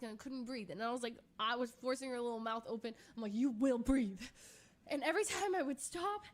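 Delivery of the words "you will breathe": loud firm command. Retold or imitated. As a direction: order her to breathe, forceful. Forcing cat to breathe, commanding.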